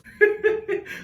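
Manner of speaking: high loud cackle; real high laughing burst; burst of laughter. Real, not acted. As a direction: crack up out loud. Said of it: "I fucking lost it".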